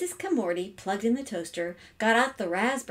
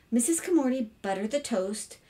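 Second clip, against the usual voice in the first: usual speaking voice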